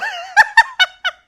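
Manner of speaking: very loud, high shrieking laugh; real laughter. Real, not acted. Storyteller cracking up at mom's reaction, loud shrieking laughter.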